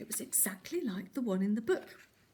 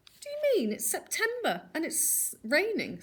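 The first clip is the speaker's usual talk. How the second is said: much higher, scornful and disbelieving; cheeky scoffing child; 9 semitones higher, 4 dB louder than usual. A narrator's reading. Fred mocking the wolf's spring morning, high cheeky scorn. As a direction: talk down to the silly wolf.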